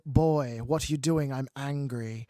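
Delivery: slow, droning monotone narration; monotone narrator. Retold or imitated. Mocking a bad audiobook reader, monotone drone. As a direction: drone through the angry line without any anger. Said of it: "The monotone drone".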